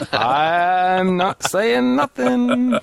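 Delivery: high, very slow, stretched-out words; drawn-out coy refusal. Pretend. Co-host dodging a joke about very immense people, drawn-out and high. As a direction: draw every word out long and high in mock innocence.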